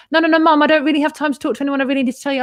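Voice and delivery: high, rushed plea down the phone; urgent protest. Retold or imitated. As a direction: cut mum off urgently, pitched high. Acted urgent phone plea to mum.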